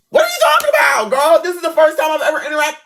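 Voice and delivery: very high, quick, incredulous yell; exasperated outburst. Retold or imitated. Girl reenacting her reply to her best friend, exasperated outburst. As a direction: shriek it in mock disbelief.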